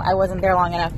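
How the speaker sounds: ordinary talk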